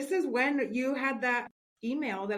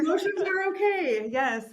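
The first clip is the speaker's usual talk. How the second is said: high, laughing echo of the words; teary laugh; 8 semitones higher, 6 dB louder than usual. Real, not acted. Guest laughing back at the host, half crying. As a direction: laugh through the tears.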